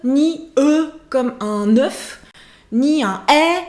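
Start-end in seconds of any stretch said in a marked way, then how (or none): 0.0-2.2 "E comme un œuf" slow, rounded like French œuf; wrong vowel shown. Shown as a demonstration. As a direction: drag out the wrong "E". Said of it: "ça ne serait ni E".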